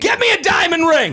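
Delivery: high, loud, giddy demand; excited shout. Pretend. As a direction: shout it like a thrilled new fiancee. Joking about needing a ring.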